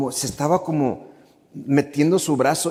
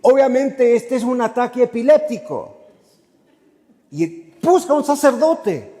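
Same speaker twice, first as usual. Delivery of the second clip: higher, louder urgent protest; exasperated insistence; 8 semitones higher, 4 dB louder than usual. Retold or imitated. He tells leader it's a seizure, raised exasperated voice. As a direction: insist he needs an ambulance. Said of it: "yo le dije".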